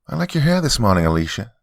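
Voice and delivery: put-on high, bright child's exclamation; a delighted kid blurting out a compliment; excited little girl. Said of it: "Harper exclaimed".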